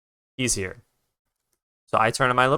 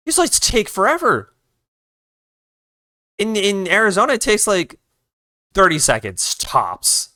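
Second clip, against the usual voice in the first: exasperated rant; 9 semitones higher than usual. Real, not acted. Ranting about slow traffic lights.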